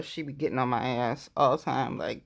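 usual speaking voice

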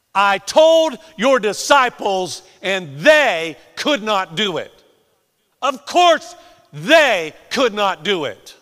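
slow, loud, hammered words; shouted emphasis. Acted. Preacher repeating the father's complaint, shouted emphasis. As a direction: shout it slowly, leaning on "they".

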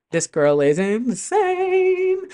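high, long-held "insane"; drawn-out amazement. Real, not acted. Podcaster marvels at the singer's nerve, amazed. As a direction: stretch the last word way up high in awe. Gushing over the mashup choice.

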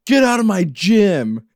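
loud, high, drawn-out hammed-up shout; mock angry order. [name] ordering a man out of his gym, hammed-up shout. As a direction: imitate throwing someone out, slow and loud. Acted confrontation from the show.

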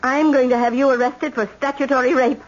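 high, cutting threat; threatening. Acted. Grandmother threatening to have Dana arrested. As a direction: spit the threat at him.

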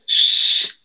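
"Shh." as a bare voiceless hiss, no vowel; just the hiss "Shh.". Shown as a demonstration. Correcting the podcast title with a hiss. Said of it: "It's not really shush".

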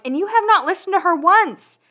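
mock sarcastic inner voice at Lauren; sarcastic inner retort. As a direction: jab at her playfully, pitch up. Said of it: "in my head I'm like".

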